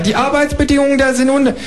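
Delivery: very high, called-out kangaroo; kangaroo calling after. Acted. Kangaroo calling after the narrator leaving, very high. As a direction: yell it after him, squeaky.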